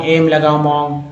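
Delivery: ordinary talk, nothing marked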